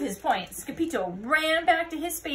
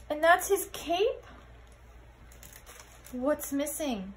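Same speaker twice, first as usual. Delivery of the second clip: plain reading voice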